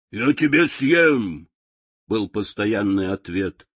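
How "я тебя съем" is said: menacing lion. A narrator's reading. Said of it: "лев грозно бросался".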